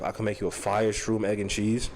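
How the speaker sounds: a lightly voiced New York hustler, low and easy; New York drug user. Quoting himself to the camera in a mild New York hustler voice. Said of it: "That's the most New York, uh, former drug user thing".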